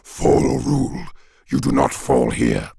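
harsh, monstrous demon voice; forceful demonic rage; raging demon. A narrator's reading. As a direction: pronounce it as a furious demon. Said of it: "it raged".

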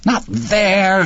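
very high, exasperated cry; impatient cry. A narrator's reading. Wife correcting where husband put turkey, impatient cry. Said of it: "she cried impatiently".

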